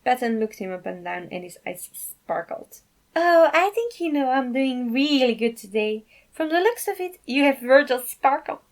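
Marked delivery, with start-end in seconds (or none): 3.2-8.6 giggly dad. Acted. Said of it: "he giggles".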